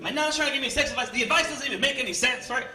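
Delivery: ordinary talk, nothing marked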